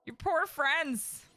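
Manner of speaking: pleading order. Real, not acted. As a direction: beg the doctor to get on with it.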